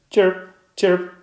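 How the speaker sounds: very high, loud chirping; cricket chirps. Acted. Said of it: "I've got it".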